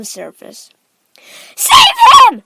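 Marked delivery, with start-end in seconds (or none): 1.5-2.4 very high, loud scream; Missus Gloop. A narrator's reading. Mother screaming for her drowning son to be saved. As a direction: scream for rescue at full voice. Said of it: "screamed Missus Gloop".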